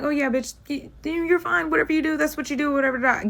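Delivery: high-pitched, placating act; feigned agreement. Retold or imitated. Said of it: "I'm just playing it cool".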